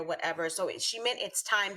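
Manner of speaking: ordinary delivery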